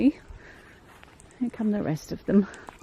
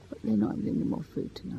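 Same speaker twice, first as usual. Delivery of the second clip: whispering; 4 semitones lower, 8 dB quieter, faster than usual. Real, not acted. Aside whispered near the birds.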